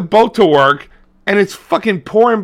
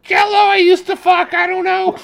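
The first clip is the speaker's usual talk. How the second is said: mock voice; 13 semitones higher than usual. Voicing character bit.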